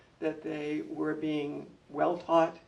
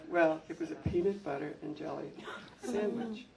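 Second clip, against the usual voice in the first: normal talking voice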